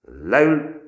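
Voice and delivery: high, loud snap; loud drill command; barked order. A narrator's reading. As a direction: bark it at the boy. Sergeant commands recruit.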